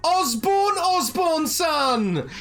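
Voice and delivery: high, slow, drawn-out announcer call; innkeeper calling out. Acted. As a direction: sing it out to the whole tavern like a ring announcer. Innkeeper saving best for last.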